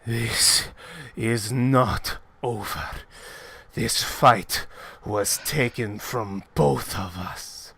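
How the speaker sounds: slow, low grave voice; measured and deep, with wounded pride; solemn fighter. Acted. Fighter declares the match stolen, slow and grave.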